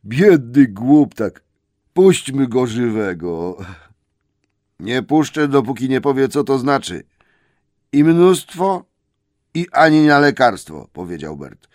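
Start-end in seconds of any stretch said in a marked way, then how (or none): 0.1-3.6 slow troll pity. Acted. Troll William pities the hobbit, slow and loud. 4.8-10.9 higher, loud troll refusal; stubborn troll. Acted. Troll Bert refuses to let the hobbit go, loud.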